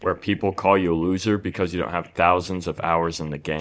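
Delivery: ordinary talk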